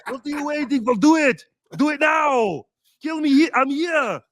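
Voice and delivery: put-on high, loud action-hero shouting in a heavy Austrian accent; Arnold Schwarzenegger impression. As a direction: bark the orders like a movie Terminator, then break into a laugh. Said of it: "Arnold Schwarzenegger".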